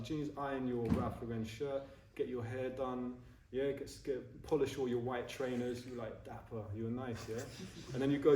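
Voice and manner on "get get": usual speaking voice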